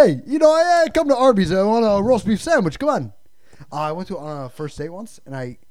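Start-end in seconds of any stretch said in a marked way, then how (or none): 0.1-3.1 loud, higher hammed-up Italian tough guy; mock Italian guy. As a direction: demand a roast beef, pushy. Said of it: "first dates with impressions".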